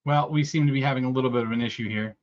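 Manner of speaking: ordinary delivery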